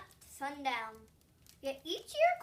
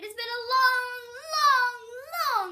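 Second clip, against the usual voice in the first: much higher and louder, sung out; emotional swell; 9 semitones higher, 13 dB louder, much slower than usual. A narrator's reading. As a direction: let the long, long soar with feeling. Emotional stretched narration.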